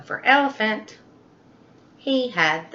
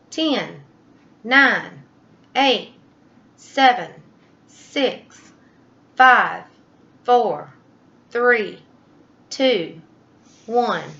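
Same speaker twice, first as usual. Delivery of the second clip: strained countdown; 4 semitones higher, 5 dB louder, slower than usual. Acted. Elephant counts down holding breath.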